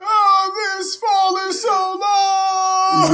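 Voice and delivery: hammed-up dying farewell, high and crying; wounded soldier gasping. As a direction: wail your last words while falling. Mock fall off cliff.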